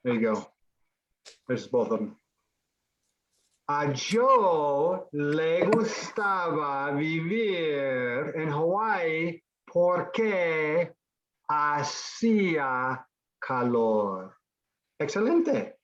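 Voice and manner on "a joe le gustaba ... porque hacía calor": slow, drawn-out sentence for learners; slow modelling. Shown as a demonstration. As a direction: space the phrases out slowly for the class. Teacher models the whole sentence.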